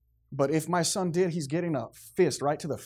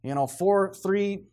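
preaching; 3 semitones higher, 5 dB louder than usual. Acted. Preaching with full force.

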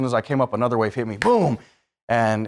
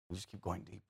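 near-whisper, sinking lower; hushed descent; 8 semitones lower, 20 dB quieter than usual. Acted. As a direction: let each deeper sink into a hush.

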